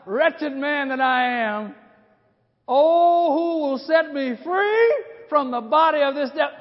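loud, slow lament that breaks into a crying wail; a desperate plea for rescue; anguished cry. Acted. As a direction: cry it out like Paul in despair. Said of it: "not only did he cry out".

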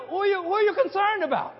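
full-voiced pulpit cry; fiery preaching. Real, not acted. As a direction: drive the question home to the pews.

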